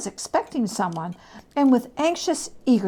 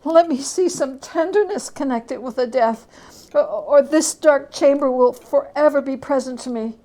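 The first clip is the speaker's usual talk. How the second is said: pleading Scrooge; 4 semitones higher, 6 dB louder than usual. Acted.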